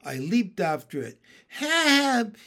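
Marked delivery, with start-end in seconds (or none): 1.5-2.4 high, loud cry for help; drawn-out pleading call. A narrator's reading. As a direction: call out for rescue in a character voice. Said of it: "he called again".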